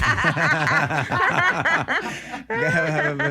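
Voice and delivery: a burst of loud, high-pitched laughter; big high laugh. Real, not acted.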